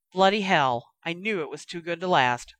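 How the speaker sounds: ordinary delivery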